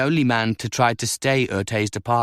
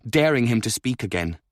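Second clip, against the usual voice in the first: plain reading voice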